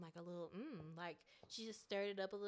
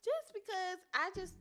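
laughing; 6 semitones higher, 7 dB louder, much slower than usual. Real, not acted. Laughing while giving rating.

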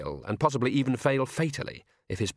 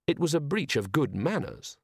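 ordinary delivery, nothing marked

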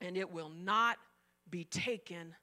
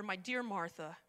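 soft, lower loving address; tender; 5 semitones lower, 5 dB quieter than usual. Retold or imitated. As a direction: say her name with love. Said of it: "Can you hear the love in his voice?".

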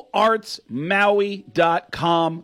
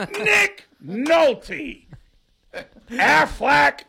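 Gilbert Gottfried impression; 6 semitones higher, much slower than usual. Comic doing Gilbert Gottfried on a radio show, high squawk. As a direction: screech it out in a grating high whine. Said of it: "Here's one as Gilbert Gottfried".

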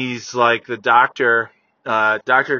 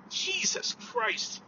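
exasperated whisper; 12 dB quieter, much slower than usual. Real, not acted. Disgusted after shouting.

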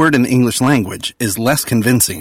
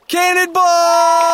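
cannonball yell; 4 dB louder, much slower than usual. Acted. Jumping into pool, shouting.

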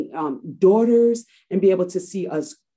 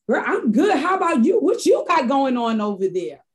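imitated friend, higher and louder, gushing back; friend's cheery reply; 7 semitones higher, 4 dB louder than usual. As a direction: playfully echo the friend's delight on the phone. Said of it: "She's like".

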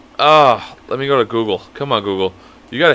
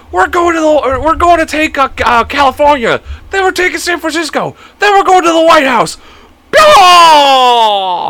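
much higher, louder rally yell; rally the crowd with rising fervor; shouting politician; 13 semitones higher, 5 dB louder than usual. Retold or imitated. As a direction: politician listing states to conquer, ranting. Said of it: "He's like".

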